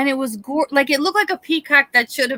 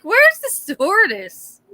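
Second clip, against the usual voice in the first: high, slow, exasperated demand; a high hammed-up plea for the stewardess; mock outraged passenger; 9 semitones higher, slower than usual. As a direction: call out for help in disbelief. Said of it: "And I'm like".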